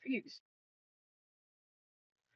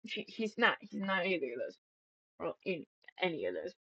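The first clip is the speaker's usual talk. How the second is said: quiet admission; 10 dB quieter, faster than usual. Retold or imitated. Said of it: "she said".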